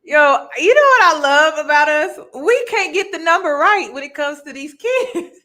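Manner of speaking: loud, high, laughing exclamation; amused outburst. Real, not acted. Teasing friends over the muddled kid count, amused outburst. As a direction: crack up while calling it out.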